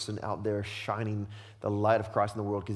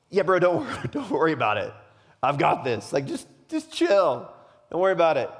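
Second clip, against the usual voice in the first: loud, higher-pitched casual brush-off; mock breezy reassurance; 4 semitones higher, 6 dB louder than usual. Jesus' imagined casual answer to John, mock breezy. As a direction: imitate a buddy waving the problem away.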